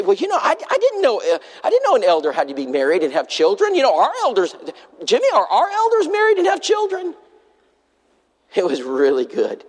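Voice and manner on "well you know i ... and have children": high, surprised put-on wife voice; preacher voicing the wife asking her husband about elders, high and amazed; imitating the wife. Mock wide-eyed discovery.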